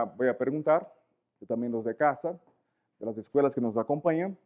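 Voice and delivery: ordinary talk, nothing marked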